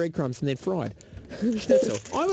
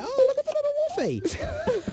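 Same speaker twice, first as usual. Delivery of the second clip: put-on much higher, cooing baby talk. Parent showing a toddler a little dog, high cooing baby talk. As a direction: squeal it like showing a toddler a puppy. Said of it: "Or like".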